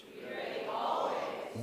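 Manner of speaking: slow whisper of Be ready always; whispered emphasis. Shown as a demonstration. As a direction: hush it with no voice. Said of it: "emphasize each word every time".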